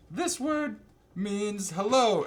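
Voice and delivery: high, slow, over-enunciated explanation; mock teaching voice. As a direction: spell it out slowly as if to aliens. Heroine teaching aliens a word.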